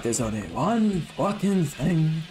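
slow, gruff Brummie gangster line; Thomas Shelby impression. Gamer showing his friend the Peaky Blinders voice, slow Brummie. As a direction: drag it out in a mild Birmingham growl. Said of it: "he goes like".

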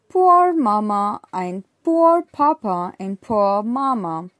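put-on slow, clear poem reading for kids; reading to children. A narrator's reading. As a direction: read the rhyme slowly and plainly for young learners. Reads poem aloud to children.

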